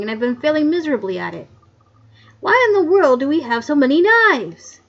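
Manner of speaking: a hint of a cranky old man, loud and flustered; grumpy old coroner. Coroner fussing at his tools, lightly voiced grumpy old man.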